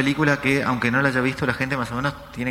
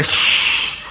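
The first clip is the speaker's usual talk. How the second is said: shushing. Retold or imitated. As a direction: shush her hard. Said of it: "yo le dije a la mujer".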